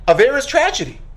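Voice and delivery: normal talking voice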